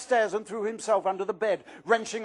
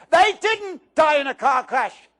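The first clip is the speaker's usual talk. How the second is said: much higher, louder, slow furious shout; furious outburst; 8 semitones higher, 9 dB louder than usual. Acted. Harry shouting at Aunt Marge over his parents, furious. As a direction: hurl the words back at her.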